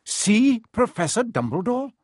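high, incredulous echo with rising end; stern teacher, doubting; suspicious repeat. A narrator's reading. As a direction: question it as something very fishy. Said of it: "as though this was a very fishy thing".

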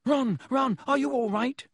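put-on high-pitched panicky girl; alarmed squeal. A narrator's reading. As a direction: squeal with concern for a friend. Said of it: "squealed Hermione".

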